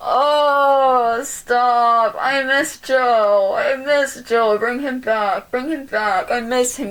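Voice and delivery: slow drawn-out blubbering; mock sobbing. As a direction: whine it like a crying child. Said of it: "He's making me cry".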